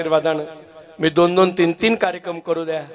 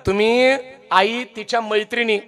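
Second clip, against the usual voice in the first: ordinary delivery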